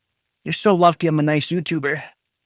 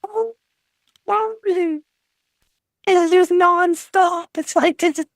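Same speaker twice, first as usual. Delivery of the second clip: exasperated whine; 14 semitones higher than usual. Real, not acted. Fed up with chat spam.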